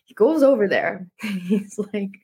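ordinary talk

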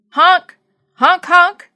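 loud, high car honks; imitated car horn. A narrator's reading. Narrator voicing the horn pushed in signals, honking. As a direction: honk out the syllables like a horn.

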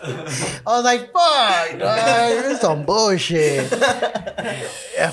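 words bubbling out through real laughter; can't stop laughing while telling it. Real, not acted. Laughing while retelling the vomit scene.

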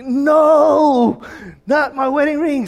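high, loud wail of protest on a drawn-out no; retold high-pitched cry of dismay; anguished cry. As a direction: cry out in disbelief at a loss. Cries out on losing the ring.

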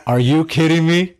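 retold amazement; amazed disbelief. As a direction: gasp it out in disbelief. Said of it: "And I started laughing".

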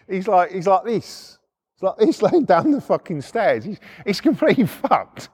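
laughing through the words; amused telling. Real, not acted. Laughing while describing fallen man.

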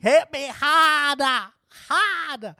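high, loud, slow mock Boston accent; playful mock Boston moan.

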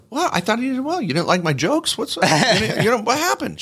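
high, incredulous what happened; mock hurt surprise. Mark asking the broker why he was dropped, mock hurt. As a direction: play wounded disbelief, lightly. Retold shock at being dropped.

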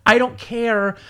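high, drawn-out exasperation; exasperated, emphatic dismissal; emphatic indifference. Real, not acted. Emphatic rant about trivial posts.

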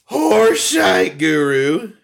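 loud, drawn-out mock title announcement; mock announcer. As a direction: proclaim it high and slow, hammed-up. Mock announcing absent co-host's nickname.

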